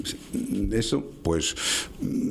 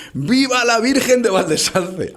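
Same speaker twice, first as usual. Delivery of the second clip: imitating a soldier's cry at full voice, much higher and louder, slow; shouted cheer; 12 semitones higher, 6 dB louder, slower than usual. Two legionnaires hailing their village's patron saint at roll call, full-throated shout. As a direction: bellow it like a legionnaire at the flag ceremony. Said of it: "a voz en grito".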